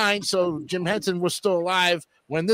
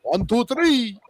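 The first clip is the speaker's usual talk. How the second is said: put-on Transylvanian accent; 4 semitones higher, slower than usual. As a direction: Count von Count voice. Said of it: "good count voice".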